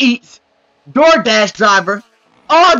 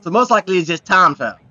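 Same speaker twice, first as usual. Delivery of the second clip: normal talking voice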